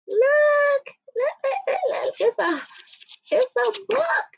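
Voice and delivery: high, squealing with joy; excited squeal. Real, not acted. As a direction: gush over the new book. Showing off book proof.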